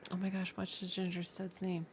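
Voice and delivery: ordinary talk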